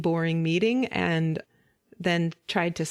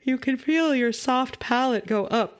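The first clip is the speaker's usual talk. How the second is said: yawning; 4 semitones higher than usual. Shown as a demonstration. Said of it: "I'm gonna yawn now".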